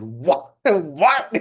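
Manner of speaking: shocked double take, rising, laughing; stunned what. Retold or imitated. Hearing Bhakta Rob plans to burn the temple, stunned laughing disbelief. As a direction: gasp it out in disbelief, half laughing. Told a guy plans arson.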